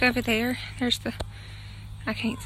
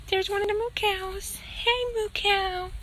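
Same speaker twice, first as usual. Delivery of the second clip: high, slow, drawn-out coo at the cow; cooing hello to a farm cow; 8 semitones higher, slower than usual. Real, not acted. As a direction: stretch it out sweetly like calling a calf.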